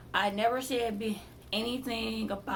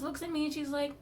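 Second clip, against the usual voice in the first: ordinary talk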